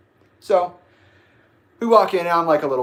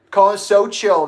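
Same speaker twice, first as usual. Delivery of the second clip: scathing retort; 8 semitones higher, 11 dB louder than usual. Real, not acted. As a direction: stretch it out with scorn. Retort after the mocking echo.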